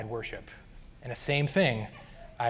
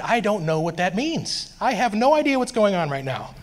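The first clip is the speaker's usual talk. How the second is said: raised, higher exasperated protest; exasperated outburst, getting louder; frustrated; 7 semitones higher, 5 dB louder than usual. Retold or imitated. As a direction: throw up your hands in frustration. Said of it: "I was just frustrated".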